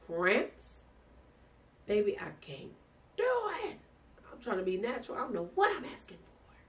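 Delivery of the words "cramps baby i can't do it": high, strained cry of pain; pained plea. Retold or imitated. In labor, pleading she can't go on. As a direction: plead through the cramps.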